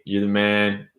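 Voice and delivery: ordinary delivery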